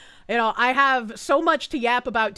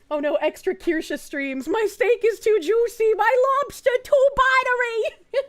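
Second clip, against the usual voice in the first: mock complaint; 10 semitones higher, 5 dB louder than usual. Streamer pretending extra streams are a hardship, mock complaint. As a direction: whine louder and higher about having it too good.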